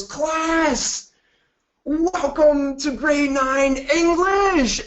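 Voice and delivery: high, slow, loud gushing welcome; overjoyed teacher. Retold or imitated. Cheerful English teacher welcoming her grade nine class, gushing. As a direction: greet the class like it's the best day ever. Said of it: "that is so very happy all of the time".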